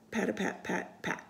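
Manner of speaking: ordinary delivery, nothing marked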